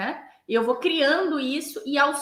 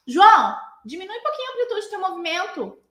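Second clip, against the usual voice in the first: name called loudly, then higher, quick cue; calling out; 7 semitones higher, 4 dB louder than usual. Acted. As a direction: call across the class to correct a student. Instructor calls across class to student. (chamando o aluno de longe)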